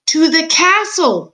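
loud, high, drawn-out words for the hard of hearing; exasperated high holler, stretched out; slow shout. A narrator's reading. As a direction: shout it slowly, word by word. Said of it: "shouted the soldier".

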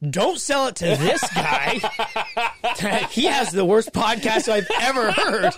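play-acted warning, laughing through it; laughing mock warning. Woman jokingly warns her husband off him, laughing mock protest.

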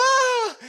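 loud, very high panicked shriek; mock scream. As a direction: scream like a terrified passenger. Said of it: "some people are like".

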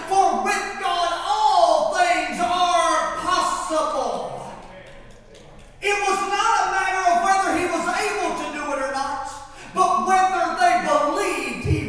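preaching shout. Acted. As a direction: push it out to the back pews.

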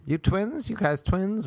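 high-pitched questioning call; mock shouting. Strangers pestering two friends in the street, mock high call. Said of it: "people just shouting".

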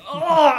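high, loud mock cry of pain; hammed-up pain yelp from a weak punch; oversold scream. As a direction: ham up a hit like a wrestler. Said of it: "screaming his head off, overselling".

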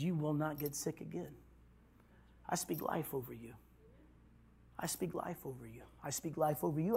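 soft, low, hushed blessing; prayer. Retold or imitated. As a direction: lay it on him gently, like a quiet laying on of hands. Retold prayer over the barber.

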